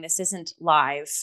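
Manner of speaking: usual speaking voice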